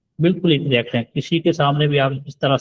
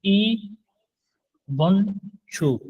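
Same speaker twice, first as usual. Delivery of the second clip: ई वन छु said slowly and carefully; slow model phrase; 6 semitones higher, much slower than usual. Shown as a demonstration. Korean teacher saying ई वन छु slowly for students. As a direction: draw out ई वन छु slowly. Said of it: "इसको बोलेंगे".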